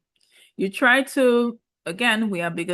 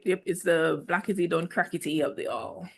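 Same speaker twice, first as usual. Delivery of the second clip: usual speaking voice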